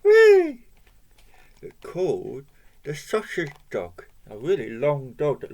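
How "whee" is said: high, loud squeal of delight; excited cheer. Pretend. Reader cheers to children before another story, high excited squeal.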